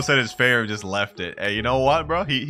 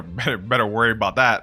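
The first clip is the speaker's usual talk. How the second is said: normal talking voice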